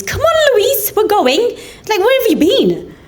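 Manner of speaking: high-pitched, hurried call to a friend; squeaky, impatient come-on-we're-going. Retold or imitated. Paula fetching Louise from bar.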